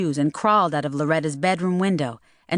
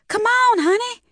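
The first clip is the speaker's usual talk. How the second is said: put-on high, pressing young woman; urgent girl voice; 4 dB louder than usual. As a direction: hurry him out in a high voice. Loretta urging Mel.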